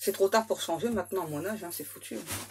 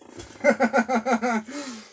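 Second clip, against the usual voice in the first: loud mocking laugh; 6 dB louder than usual. Real, not acted. Reader to the guides about free will, loud mocking laugh. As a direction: burst of loud scornful laughter.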